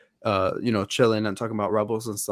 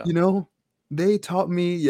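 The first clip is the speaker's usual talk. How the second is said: mock tearful, higher and slow; imitating sobbing; 7 semitones higher, slower than usual. Retelling Ezra's grief to the co-host, mock sob. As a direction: retell a boy breaking down in tears, half-playing it. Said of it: "starting to sob and being like".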